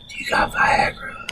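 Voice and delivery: whispered, confidential question; hushed customer. Retold or imitated. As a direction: imitate someone asking discreetly. Customer secretively asks for Viagra.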